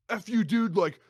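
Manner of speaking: high, loud mock outburst; hammed-up angry gamer retort; toxic teammate. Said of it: "you wanna talk about toxicity".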